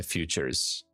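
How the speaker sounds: ordinary talk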